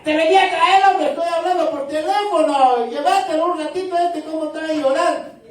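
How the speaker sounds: high, loud harried father on the phone; dad on the phone pushing the crying kid away, high and loud; flustered dad. Retold or imitated. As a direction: imitate a father losing patience. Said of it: "deje usted al papá".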